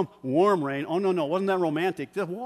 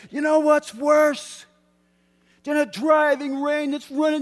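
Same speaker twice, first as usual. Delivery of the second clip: coach's rallying shout; 14 semitones higher, 10 dB louder than usual. Retold or imitated. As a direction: fire the team up over the roar of rain. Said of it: "And I said".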